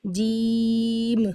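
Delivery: جیم stretched very slowly; long jeem. Shown as a demonstration. Teacher showing the long letter name. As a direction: draw out جیم fully. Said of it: "لمبا حرف ہے".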